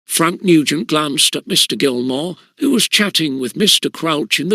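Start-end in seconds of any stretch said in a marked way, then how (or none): none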